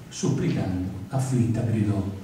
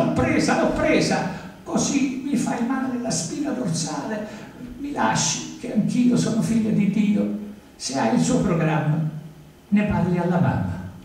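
much higher, hammed-up squeal of triumph; mock triumphant cry; 9 semitones higher than usual. Painter Armando grabbing his new conquest, hammed-up high squeal. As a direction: crow it like a silly triumph.

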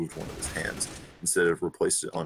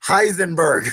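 louder, higher name call; 11 semitones higher, 9 dB louder, slower than usual. Acted. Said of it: "I said a bit louder".

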